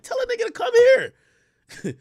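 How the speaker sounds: high, slow, dismissive drawl; mock high reply. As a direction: toss it off lazily.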